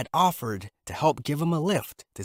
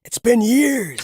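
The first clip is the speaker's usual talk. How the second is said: higher, fast eager greeting; a touch of excitement; excited Zalman; 9 semitones higher, 5 dB louder than usual. Acted. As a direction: glad to see an old friend. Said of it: "said Zalman excitedly".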